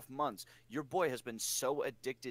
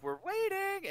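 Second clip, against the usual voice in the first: high singsong re-enactment; imitated waiting call; slower than usual. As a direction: mimic a high, singsong prompt. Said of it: "and then we're like".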